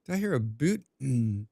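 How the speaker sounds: ordinary talk, nothing marked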